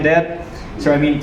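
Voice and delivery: ordinary talk, nothing marked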